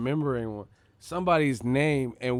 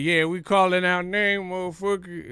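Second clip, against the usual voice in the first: higher, louder call-out while pointing; mock call-out; 7 semitones higher, 4 dB louder than usual. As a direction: call them out like you mean it, half-joking. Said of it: "I'm like".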